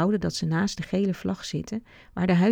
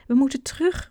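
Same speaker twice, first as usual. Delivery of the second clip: high, louder urgent call; girl calls out that they must go back; 7 semitones higher, 3 dB louder than usual. A narrator's reading. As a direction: shout it so the others hear. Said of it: "roept Noah".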